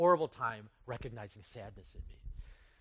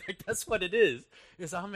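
higher, laughing through the words; laughing admission; 5 semitones higher, 5 dB louder than usual. Real, not acted. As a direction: laugh at yourself as you admit it. Laughing at his own admission.

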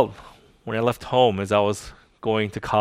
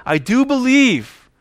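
higher, slower pleading cry; desperate cry of faith; 11 semitones higher, 5 dB louder, slower than usual. Acted. Believer crying out to the Lord, pleading. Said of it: "cry out to him again and again".

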